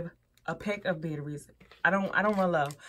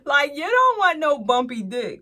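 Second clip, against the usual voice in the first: amused outburst; 8 semitones higher, 9 dB louder than usual. Real, not acted. Laughing at the bumpy design.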